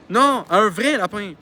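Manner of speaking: loud, swooping stress on "vrai"; insistent correction. Acted. Friend correcting a misunderstanding, insisting on "vrai". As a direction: hit "vrai" hard with a rising-falling swoop.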